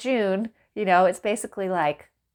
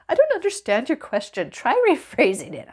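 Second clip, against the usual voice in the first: higher, sing-song bot voice; chirpy canned bot reply, mocking; mock chatbot; 5 semitones higher, 4 dB louder than usual. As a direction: parody the unhelpful chatbot. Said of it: "it's like".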